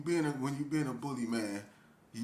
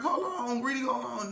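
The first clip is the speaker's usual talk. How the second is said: high-pitched acted plea; pleading mock quote; 9 semitones higher than usual. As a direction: plead it high, imitating a bullied man. Acting Bert pleading with Gritty.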